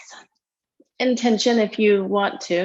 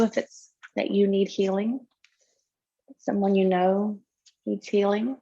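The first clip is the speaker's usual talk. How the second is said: guided meditation. Real, not acted. Yoga teacher settling students into tonight's practice, guided meditation. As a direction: lead them gently into breath awareness, unhurried.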